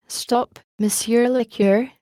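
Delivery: ordinary delivery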